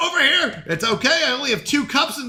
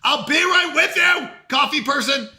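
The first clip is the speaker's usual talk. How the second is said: calling out; 5 semitones higher than usual. Acted. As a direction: promise the customer you're coming. Calling to waiting customer.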